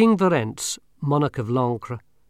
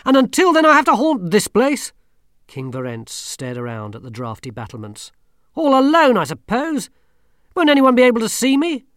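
distressed king; 11 semitones higher, 8 dB louder than usual. Acted. King's dismay.

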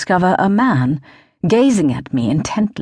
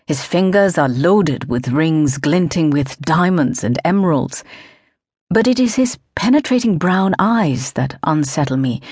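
plain reading voice

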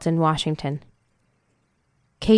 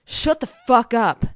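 slow, higher-pitched angry order; angry outburst; 5 semitones higher, 4 dB louder, slower than usual. A narrator's reading. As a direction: silence a crying child with force. Man snaps at sobbing child.

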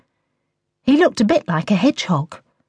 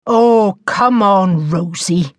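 mildly weary teacher; tired, let-down reply; 4 dB louder, slower than usual. A narrator's reading. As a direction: start louder, then drop off wearily. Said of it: "replied in a tired voice".

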